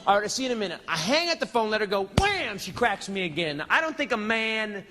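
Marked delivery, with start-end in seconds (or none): 2.2-2.6 high, punched-out comic impact; exclaimed slap effect. Retold or imitated. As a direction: fire it out like a sudden blow. Voices the slap.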